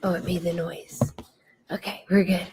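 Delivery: real whispered relief. Real, not acted. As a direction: breathe it without voice. Whispering off-camera.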